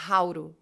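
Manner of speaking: "hauro" as one linked word; connected speech. Shown as a demonstration. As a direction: model "hauro" with a tapped R for the T. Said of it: "Tenta falar".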